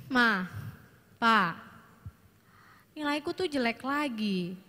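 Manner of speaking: mild downcast complaint; disappointed teen. Shown as a demonstration. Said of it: "dengan intonasi seperti itu". (anak mengadu nilai jelek dengan kecewa)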